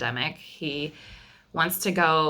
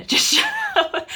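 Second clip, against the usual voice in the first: much higher, louder, breaking into laughter; laughing shout; 7 dB louder than usual. Retold or imitated. Wife in labor telling husband off, laughing yell. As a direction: yell it and crack up. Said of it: "And I'm like".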